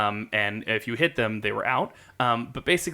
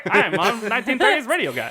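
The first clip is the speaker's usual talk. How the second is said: imitating old radio guy; 11 semitones higher, 5 dB louder than usual. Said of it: "he comes up with".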